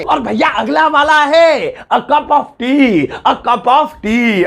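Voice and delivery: high, slow showman announcing; game-show style reveal; announcer intro. Acted. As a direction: announce the next word like a host. Recurring segment announcer intro.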